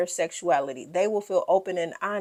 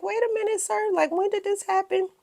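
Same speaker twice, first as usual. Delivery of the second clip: much higher, excited mom voice; high mock voice of a curious mother; imitating Toya; 13 semitones higher than usual. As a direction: squeal it like a nosy mom.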